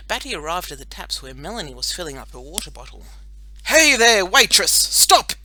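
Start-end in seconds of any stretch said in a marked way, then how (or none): 3.6-5.3 loud, high heroic call; brave superhero shout. A narrator's reading. Boy superhero halts girl at the taps, shouting bravely. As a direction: holler a warning across the playground. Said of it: "he shouted in his brave superhero voice".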